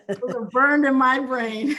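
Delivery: loud, slow, laughing delivery. Real, not acted. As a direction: say it through a big laugh. Laughing over remembered catchphrases.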